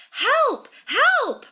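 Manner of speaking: high-pitched, panicked cries for help; two high, desperate calls; crying for help. A narrator's reading. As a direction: cry out in alarm, voice way up. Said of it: "Brenna cries".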